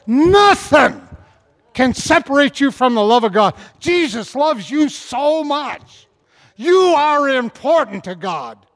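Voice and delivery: high, loud, ringing sermon climax; preaching at full force. Acted. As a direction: thunder the promise to the church. Full-force reading and exhortation.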